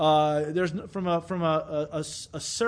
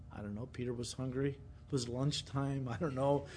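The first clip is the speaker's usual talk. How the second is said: low, soft aside; hushed, unsure shrug of a remark; offhand musing; 5 semitones lower, 10 dB quieter than usual. Real, not acted. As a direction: toss it off quietly, as a loose guess. Tossing off offhand guesses at the reason.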